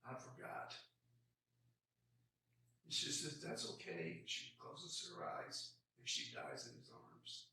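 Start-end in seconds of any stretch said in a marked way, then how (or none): 0.1-0.7 ashamed whisper. Retold or imitated. 3.4-7.4 low soft dying breath; hushed, barely there, fading out; fading whisper. Retold or imitated. Child dying.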